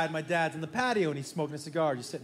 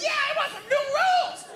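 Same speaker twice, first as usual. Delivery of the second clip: put-on very high squeaky kid cheer; a pack of six-year-olds cheering, pitched way up; squealing child hype; excited little kids. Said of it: "I'm like".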